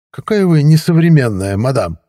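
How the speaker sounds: high, loud affected voice; mincing. A narrator's reading. Third neighbour mincingly correcting the woman, high and loud. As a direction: say it prissy and mannered. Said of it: "жеманно сообщил".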